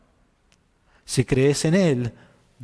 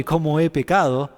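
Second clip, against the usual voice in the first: usual speaking voice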